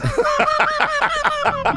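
high burst of laughter. Real, not acted. Host laughs at prank.

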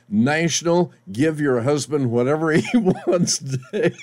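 laughs through the joke holiday; can't keep a straight face; real laughter breaking in; laughing. Real, not acted.

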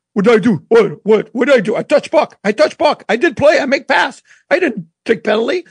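put-on protesting in broken, Russian-accented English; quick, indignant Russian accent, words in short bursts. Penalized hockey player protesting the call, Russian accent. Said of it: "Max in his Russian accent is like".

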